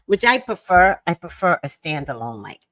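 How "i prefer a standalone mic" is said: normal talking voice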